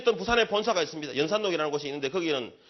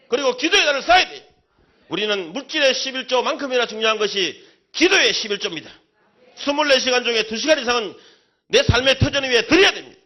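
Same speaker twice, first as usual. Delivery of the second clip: loud, high preacher's exhortation; fiery preaching; 5 semitones higher, 11 dB louder than usual. Acted. Pastor exhorting the congregation, loud fiery preaching. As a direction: drive it home to the congregation with full force.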